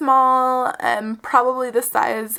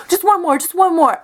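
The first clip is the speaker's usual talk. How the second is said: higher, louder wheedling; imitating pleading date; 6 semitones higher, 7 dB louder than usual. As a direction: beg insistently in a mock-eager voice. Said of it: "And he goes".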